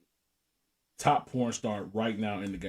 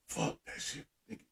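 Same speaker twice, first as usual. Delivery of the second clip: hushed, breathy whisper under the breath; whispered aside; 5 semitones lower, 13 dB quieter than usual. Retold or imitated. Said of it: "I was like, I was like".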